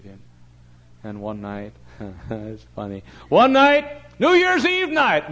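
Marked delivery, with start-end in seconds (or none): 3.3-5.3 high, very loud storytelling cry; preacher's shout. Real, not acted. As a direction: launch the story at full force. Restarts the anecdote at full force.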